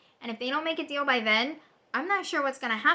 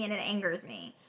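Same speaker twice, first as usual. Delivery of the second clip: ordinary talk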